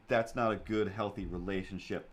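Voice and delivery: ordinary talk, nothing marked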